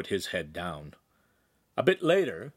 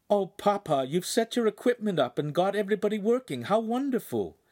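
put-on bright, much higher girl's voice; cheerful girl voice; 11 semitones higher than usual. A narrator's reading. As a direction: gush to Papa. Daughter greets father.

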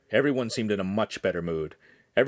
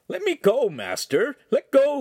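put-on high, loud teen protest; crying out; 5 dB louder than usual. A narrator's reading. Lincoln to the master from the headlock, crying out. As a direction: plead loudly, voice shooting up. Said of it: "Lincoln cried".

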